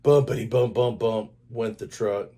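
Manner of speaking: ordinary delivery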